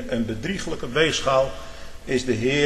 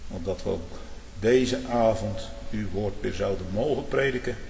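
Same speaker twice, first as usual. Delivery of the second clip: closing prayer; 5 semitones lower, 5 dB quieter than usual. Acted. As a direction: pray humbly to God.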